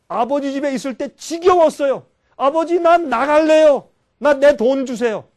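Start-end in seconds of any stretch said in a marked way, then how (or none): 2.3-5.3 loud, slow, demanding son; insolent son. Retold or imitated. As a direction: demand your share like a brash son. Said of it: "이렇게 건방이". (아버지에게 돈을 요구하는 건방진 아들의 큰 소리)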